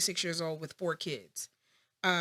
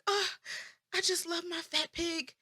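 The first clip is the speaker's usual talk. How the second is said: doting dad; 10 semitones higher than usual. Retold or imitated. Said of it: "And he was like".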